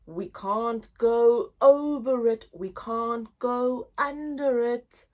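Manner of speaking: lively, sing-song picture-book reading; reading to children. Acted. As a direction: read it big for little listeners. Teacher reads the bear hunt story.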